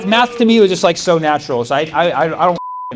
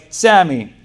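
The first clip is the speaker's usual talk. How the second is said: stern professor; 4 semitones higher, 7 dB louder than usual. Retold or imitated. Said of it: "then he was like".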